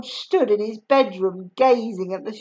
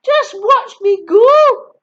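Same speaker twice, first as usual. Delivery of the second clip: high, loud, slow crow; Grandma boasting from above; gleeful boast; 9 semitones higher, 10 dB louder, slower than usual. Acted. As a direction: boast triumphantly. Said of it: "she called down".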